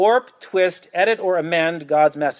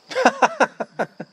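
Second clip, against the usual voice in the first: mocking laugh. Acting the mocking Athenians.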